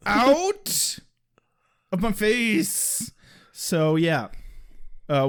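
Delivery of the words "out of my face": high, slow goofy squeak; silly high voice. Acted. Repeating in silly voice.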